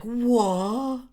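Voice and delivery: long, high, loud cry of astonishment; amazed. Acted. Luffy reacts to the attack, amazed. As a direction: stretch the word out high and loud.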